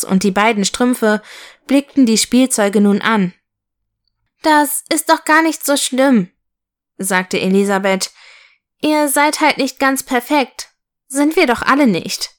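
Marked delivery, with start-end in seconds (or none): 4.4-6.3 high, kindly little voice; small, higher-pitched comforting character; consoling little locomotive. Acted. Locomotive comforts the rejected toys. 8.8-12.3 high, kindly little voice; small, higher-pitched comforting character; consoling little locomotive. Acted. Locomotive comforts the rejected toys.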